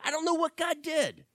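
high, bewildered mock soldier voice; confused Egyptians. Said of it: "the Egyptians are saying".

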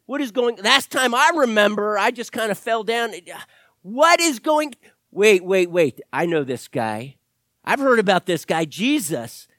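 put-on loud, rising confused young man; bewildered risen man. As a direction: wake up baffled in a coffin.

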